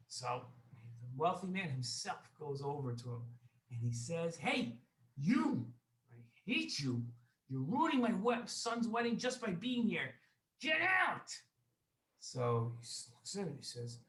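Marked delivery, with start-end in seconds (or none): 4.4-11.3 high, loud acted tirade; very loud anger. Wealthy man confronts his enemy at the wedding, loud and angry. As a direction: build to a high, loud dismissal. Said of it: "very loud".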